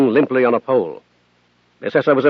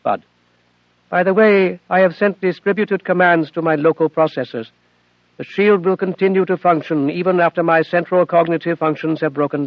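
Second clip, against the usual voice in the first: put-on higher, earnest young machine voice; AI girl Athena; 3 semitones higher than usual. Athena explains to Bud why she hid it, earnest and higher. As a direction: plead gently as a devoted daughter.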